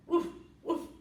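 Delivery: short, loud breathy woofs; dog bark. A narrator's reading. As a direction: bark twice like a dog in a children's story. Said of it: "doggy went".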